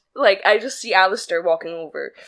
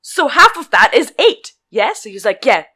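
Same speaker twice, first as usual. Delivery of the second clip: firm, patronising; 2 semitones higher, 7 dB louder than usual. Retold or imitated. Explaining to customer.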